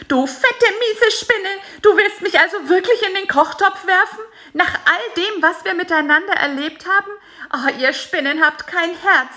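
high, loud, furious scolding; angry scolding. A narrator's reading. Fly Bisi rants at spider Karl-Heinz, high and furious. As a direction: berate the spider for betraying him. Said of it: "schimpfte er".

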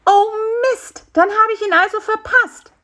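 high, loud wail of dismay; high-pitched, upset insect; dismayed little fly. A narrator's reading. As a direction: lament a missed appointment in a tiny high voice. Fly realises missed appointment.

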